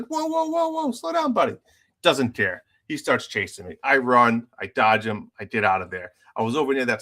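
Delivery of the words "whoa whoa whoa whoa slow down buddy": very high-pitched string of whoas; mock alarmed protest. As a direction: imitate frantically calling off an attacker. Retelling his plea to attacker.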